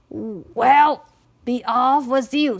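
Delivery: loud, brusque troll dismissal; gruff troll. A narrator's reading. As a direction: send them off with a loud "Well".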